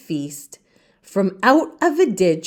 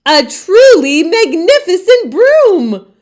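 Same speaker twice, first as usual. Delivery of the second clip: much higher and louder, amazed; storyteller revealing the broom; grand reveal; 9 semitones higher, 7 dB louder than usual. A narrator's reading. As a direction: announce it with wonder.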